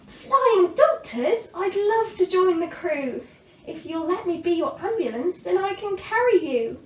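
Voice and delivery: put-on thrilled young dragon; excited Zog. A narrator's reading. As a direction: offer eagerly. Zog eagerly joins.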